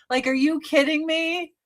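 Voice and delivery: ordinary talk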